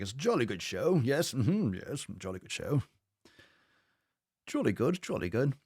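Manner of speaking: lightly hammed-up posh British gent; plummy British approval, tossed off as a joke; mock posh British. Praising Mariah's gains in a mock posh British accent. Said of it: "soundboards where it's like".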